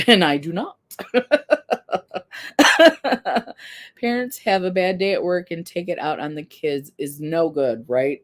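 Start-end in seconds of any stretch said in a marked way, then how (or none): 0.1-0.8 louder, breaking into a laugh; amused, laughing admission. Real, not acted. Laughs about her own messy house. 1.0-4.0 loud, high burst of laughter; big self-amused laugh; real laugh. Real, not acted. Laughs at her own admission.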